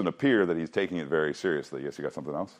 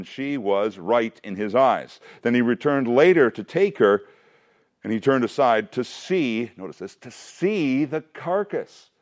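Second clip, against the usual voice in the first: reading the verse aloud, a little higher; scripture reading; 5 semitones higher than usual. Acted. Teacher reading Samson's story aloud to his class, steady reading voice. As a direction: read the Bible passage to the class.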